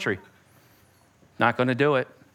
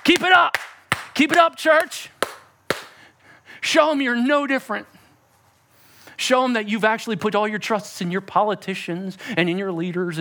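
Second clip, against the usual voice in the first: gloating devil. Pretend. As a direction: egg the church on with mocking glee. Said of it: "You know what the devil's doing?".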